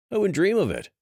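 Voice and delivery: feigned innocence; innocent lie. Acted. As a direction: lie sweetly with a straight face. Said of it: "Dash lied".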